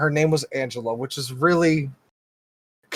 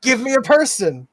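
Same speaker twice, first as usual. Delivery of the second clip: higher, insistent demand; retold yelling; 5 semitones higher, 5 dB louder than usual. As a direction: shout for a human. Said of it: "I start screaming".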